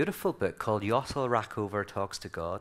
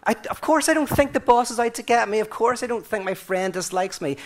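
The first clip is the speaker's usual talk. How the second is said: a much higher, louder wife's voice, fed up; exasperated wife; 10 semitones higher, 5 dB louder than usual. Retold or imitated. Wife answering her husband, higher and exasperated. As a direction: throw the husband's logic back at him. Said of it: "his wife could turn around and go".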